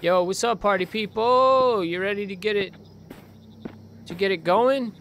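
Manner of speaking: playful high-pitched party call; mock party host. Mock party greeting to empty dark.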